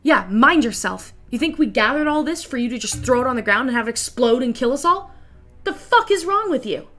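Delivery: loud, heated telling-off, climbing high at the end; angry boss. Acted. Boss berating the sorry worker, loud and heated. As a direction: tear into the worker for nearly blowing everyone up.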